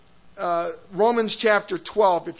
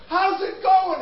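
much higher, loud cheerful hey bro; friendly greeting; 10 semitones higher, 3 dB louder than usual. Retold or imitated. Muhammad greeting the preacher warmly, high and loud. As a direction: call out like a glad old friend. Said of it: "And he goes".

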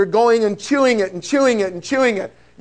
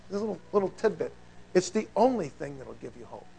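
much softer, low confiding tone; confiding hush; 5 semitones lower, 12 dB quieter, faster than usual. Real, not acted. Preacher sharing a secret tidbit, hushed. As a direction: lean in and drop the voice. Confiding key point to congregation.

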